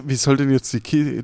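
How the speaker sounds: normal talking voice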